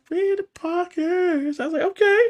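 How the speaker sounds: imitated shocked squeal; high mock surprise. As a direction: squeak it out in disbelief.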